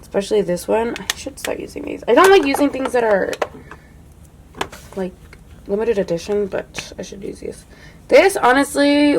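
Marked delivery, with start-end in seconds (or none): 1.0-2.0 soft unvoiced whisper to herself; whispered aside. Real, not acted. Reminding herself to use the palette, whispered. Muttered note-to-self aside. 6.5-7.6 softer unvoiced whisper; whispered aside. Real, not acted. Scolding herself gently about limited editions, whispered. Muttered note-to-self aside.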